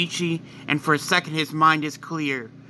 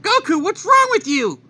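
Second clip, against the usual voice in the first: much higher, alarmed wife voice; high Chi-Chi voice; 5 dB louder than usual. Acted. Wife shouting at husband who attacked her, high and alarmed. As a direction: cry out in a high, shocked pitch.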